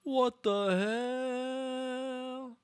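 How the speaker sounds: mock Saruman. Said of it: "Saruman's like".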